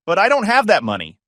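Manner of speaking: don't pushed high and loud, falling; loud desperate plea on don't; desperate wail. Acted. Nicole pleading, about to cry.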